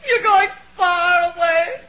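loud, slow, crying far away; stretched, crying lament; wailing sob. Acted. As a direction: wail it out in hammed grief. Wife wailing at deathbed.

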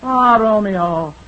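slow, overdone Shakespeare recital; hammy Juliet. Pretend. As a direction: ham up Juliet's balcony line. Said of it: "I'm taking dramatic lessons".

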